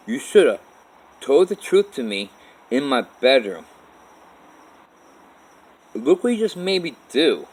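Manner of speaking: put-on a girl's voice scolding him for lying; retold yelling. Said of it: "she still yelling at me".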